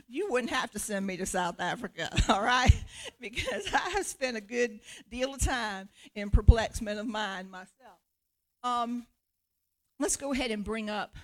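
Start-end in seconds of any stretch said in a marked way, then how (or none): none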